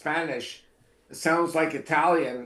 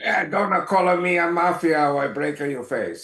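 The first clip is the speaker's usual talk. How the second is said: put-on a light Italian mafia accent, a bit louder; mock Italian mobster; 3 dB louder than usual. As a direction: play a joking Italian tough guy threatening. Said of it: "a little hint of a, a pass of the meatball".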